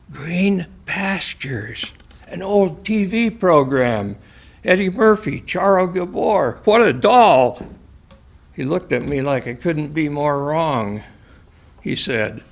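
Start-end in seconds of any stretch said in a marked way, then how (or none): 0.1-7.6 lightly voiced odd patient, higher; Alphonse voice. Narrator voicing the patient Alphonse to Sterling, lightly higher.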